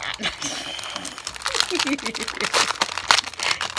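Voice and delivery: loud, long giggling fit; burst of laughter. Real, not acted. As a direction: crack up in real laughter.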